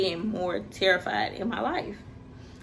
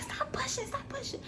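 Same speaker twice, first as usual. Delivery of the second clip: high, hushed urgent plea; imitating mom's frantic hush; mom whispering stop; 9 dB quieter than usual. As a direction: mom begging her to stop pushing. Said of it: "She was like".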